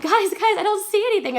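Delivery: high, loud mock panic; panicked appeal. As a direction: call out urgently to the group. Panicked message to friends, retold.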